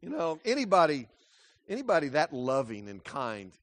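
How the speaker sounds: usual speaking voice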